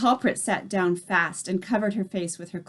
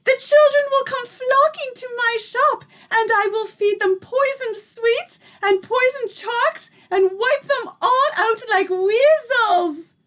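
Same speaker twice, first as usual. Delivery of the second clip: much higher, louder, gleeful witch cry; excited shout; 5 dB louder than usual. A narrator's reading. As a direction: leap up and blurt it out in wicked delight. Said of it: "feet and shouted".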